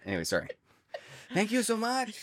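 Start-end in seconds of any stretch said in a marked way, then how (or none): 1.3-2.2 very high imitation of the actor's accent; Eugenio Derbez impression. Repeating the actor's thanks for the co-hosts, high mimicked accent. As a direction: put on the actor's accent, pitched way up. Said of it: "it was this, his accent".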